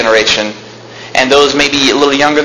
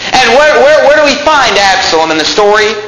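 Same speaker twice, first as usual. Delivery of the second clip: fiery preaching; 9 semitones higher than usual. Acted. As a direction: preach it up to the rafters. Preaching at full force.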